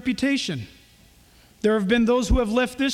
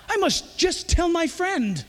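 higher, slow, excited cat; storybook cat voice; 7 semitones higher than usual. Acted. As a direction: read it as an eager little cat. Cat voice in picture book.

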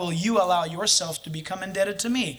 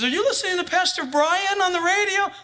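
higher, lightly voiced wife; wife on the phone, mock higher voice; imitating wife; 10 semitones higher than usual. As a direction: pitch it up for the wife's question. Quoting his wife's phone call.

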